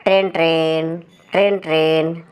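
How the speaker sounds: spoken monotone doorbell ring; imitated ring-ring of a house bell; doorbell imitation. A narrator's reading. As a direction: chant it evenly like a ringing bell. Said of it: "अचानक घर की घंटी बज उठी".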